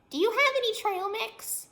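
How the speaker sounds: very high, loud critter voice; a tiny rodent begging for snacks; squeaky chipmunk voice. Acted. Chipmunk asks the girl for trail mix, high and squeaky.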